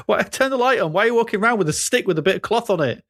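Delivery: high-pitched, bewildered telling-off; mock exasperated scolding. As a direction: play someone scolding a ridiculous housemate.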